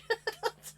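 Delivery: sudden high giggle; high laugh. Real, not acted. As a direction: burst out laughing. Laughing burst.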